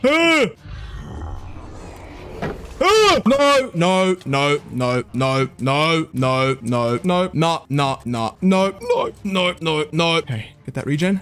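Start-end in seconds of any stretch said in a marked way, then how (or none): 0.1-3.2 startled scream. Real, not acted. Startled screams during game danger. 3.3-10.2 rapid string of alarmed refusals; panicked repetition. Real, not acted. Panicked escape in game.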